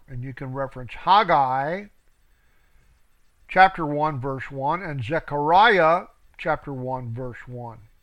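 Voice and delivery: ordinary delivery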